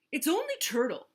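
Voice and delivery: loud, high scornful sneer. A narrator's reading. Vulture dismisses Turtle to Walrus, sneering. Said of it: "sneered Vulture".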